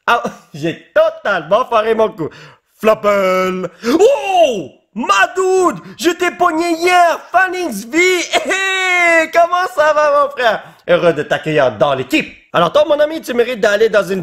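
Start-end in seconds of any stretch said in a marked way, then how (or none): 3.9-10.6 excited greeting. Real, not acted. Thrilled to pull Phoenix card.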